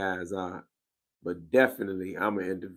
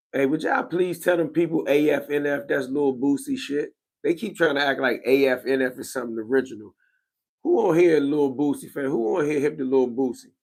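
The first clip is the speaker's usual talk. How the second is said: hyped call-out; 5 semitones higher, 7 dB louder than usual. Real, not acted. Hyped rant calling out chat.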